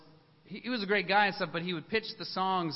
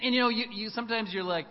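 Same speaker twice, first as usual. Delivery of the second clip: usual speaking voice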